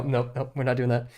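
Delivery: ordinary talk, nothing marked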